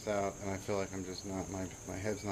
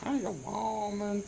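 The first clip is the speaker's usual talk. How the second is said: high, slow put-on Southern accent; 13 semitones higher, slower than usual. Wife replaying her retort to her man, in a mock Southern accent. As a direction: drag it out in a mock Southern twang. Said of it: "Like Southern".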